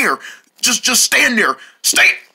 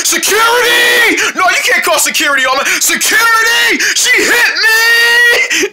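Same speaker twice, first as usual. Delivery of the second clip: yelling for help; 9 semitones higher than usual. Acted. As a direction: call out for the guard at full volume. Yelling for security.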